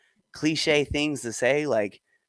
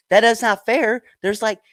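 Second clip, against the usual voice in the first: high-pitched, amused indignant argument; mock-exasperated protest; 10 semitones higher, 3 dB louder than usual. Arguing with New Englanders who call her Southern, exasperated. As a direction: argue it out, pitched well up.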